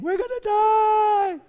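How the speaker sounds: high, slow, hammed-up panicked scream. Israelites at the Red Sea screaming in hammed-up panic. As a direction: wail it like a terrified crowd. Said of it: "people around him screaming".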